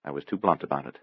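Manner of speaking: inner thought. A narrator's reading. Sister blames herself for bluntness, soft rueful thought. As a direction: say it privately to yourself. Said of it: "Penny thought".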